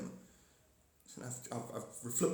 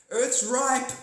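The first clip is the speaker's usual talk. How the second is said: higher, louder announcer-ish alien voice; mock alien message; 9 semitones higher, 6 dB louder than usual. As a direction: proclaim it like a cosmic broadcast. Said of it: "Don't forget to like and share".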